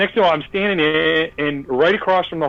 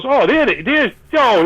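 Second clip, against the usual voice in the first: high, loud-edged retold "oh"; excited spotting; 9 semitones higher, 4 dB louder, slower than usual. Keith spotting Gene down the hall, excited. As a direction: stumble excitedly on sighting someone.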